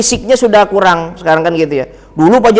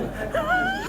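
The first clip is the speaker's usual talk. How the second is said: sudden very high, soft burst of laughter; real high-pitched giggle; 13 dB quieter than usual. Real, not acted. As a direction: squeal of laughter at the joke. Laugh after the punchline.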